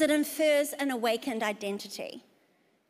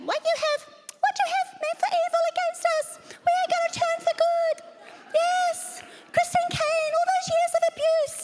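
high shrill mock battle cry; faster than usual. Preaching at enemy, high, loud.

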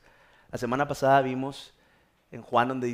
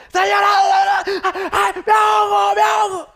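very high, loud wailing shriek; drowning cries; 12 dB louder, slower than usual. Acted. Mother crying out in shallow water, hammed-up drowning. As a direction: scream for help in mock panic.

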